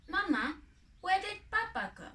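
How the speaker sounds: high, small questioning voice; a little bird asking for papa; lightly voiced young chick; small child bird voice. Little bird asks mother.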